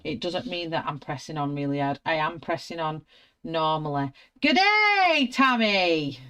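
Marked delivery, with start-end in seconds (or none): 4.4-6.2 high, loud mock Australian hello; mock Australian greeting. Playful mock Australian hello to viewer Tammy. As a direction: have a go at an Aussie greeting, badly. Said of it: "but I can't talk Australian".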